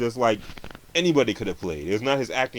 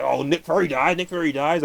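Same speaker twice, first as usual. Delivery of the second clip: higher, mock-gleeful blurting; mocking spoiler blurt; 6 semitones higher, 4 dB louder than usual. Mocking a reviewer who blurts the spoiler, higher and teasing. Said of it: "He's like".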